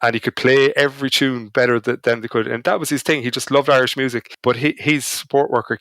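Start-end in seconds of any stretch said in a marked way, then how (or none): none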